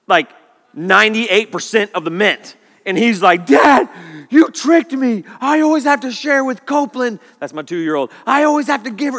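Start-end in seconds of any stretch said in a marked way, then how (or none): 3.4-7.2 put-on high, loud whiny kid protest; angry little boy. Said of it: "He is angry". 8.2-9.2 put-on angry little boy. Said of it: "He is angry".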